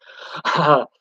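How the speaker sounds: single rueful chuckle; one brief burst of laughter; short laugh. Real, not acted. Streamer laughing off a failed tournament hope, short chuckle.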